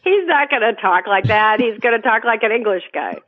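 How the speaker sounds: ordinary talk, nothing marked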